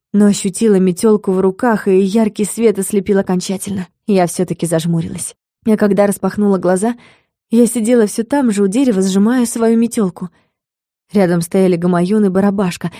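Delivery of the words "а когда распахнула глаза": ordinary delivery, nothing marked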